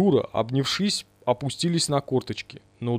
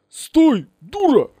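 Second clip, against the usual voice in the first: high, loud barked order; loud sharp command; commanding shout; 12 semitones higher, 6 dB louder, much slower than usual. Acted. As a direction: yell at her to stop. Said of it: "приказал он".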